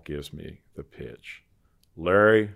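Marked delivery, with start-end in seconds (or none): 1.9-2.5 big deep voice. Retold or imitated. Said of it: "big, deep voice".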